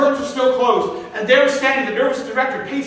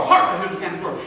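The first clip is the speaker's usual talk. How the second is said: जल्दी-जल्दी घबराकर पंक्ति दोहराना; frantic rehearsal; 8 semitones higher, faster than usual. Shown as a demonstration. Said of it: "he's running his line".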